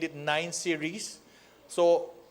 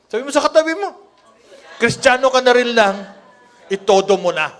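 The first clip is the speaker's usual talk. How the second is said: repeated with a much louder, higher push; 4 semitones higher, 11 dB louder, faster than usual. Shown as a demonstration. Preacher urging the crowd to say it louder. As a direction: say it again, bigger and louder.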